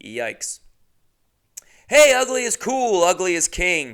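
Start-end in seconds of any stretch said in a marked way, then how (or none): 1.9-3.9 high, loud proclamation; hammed-up shout of celebration; mock hype cheer. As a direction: holler it like a rallying slogan. Mock cheer for ugly models.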